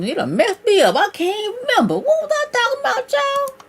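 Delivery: high, squeaky comic exasperation; rising into a high falsetto fluster; playfully flustered. Real, not acted. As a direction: wail playfully about losing your thread. Lost her train of thought.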